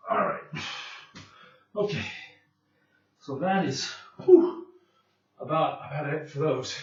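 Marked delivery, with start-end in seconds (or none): 4.2-4.7 loud whew. Real, not acted. Finishing the exercise set.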